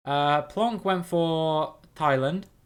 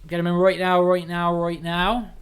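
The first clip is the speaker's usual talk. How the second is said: Cornish accent; 4 semitones higher, 5 dB louder than usual. Real, not acted. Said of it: "I'm going really Cornish".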